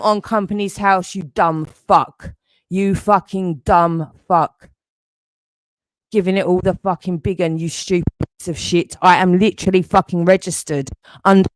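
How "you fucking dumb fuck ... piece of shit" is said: normal talking voice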